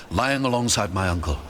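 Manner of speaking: ordinary delivery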